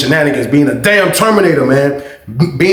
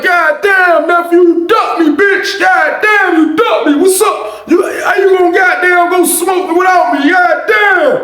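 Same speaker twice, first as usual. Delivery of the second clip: put-on yelling old uncle; 12 semitones higher, slower than usual. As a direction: play an offended uncle hollering through the door.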